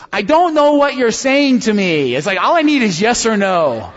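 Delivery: high, loud imitation of a baffled child; exasperated little kid. Dad imitating foster son wanting a yes or no, exasperated. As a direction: plead like a fed-up five-year-old. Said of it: "he just looks at me and he goes".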